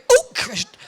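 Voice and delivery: sudden high yelp of surprise; mock shock, voice leaping high; startled exclamation. Real, not acted. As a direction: jump up in pitch, caught off guard. Reacts to something seen.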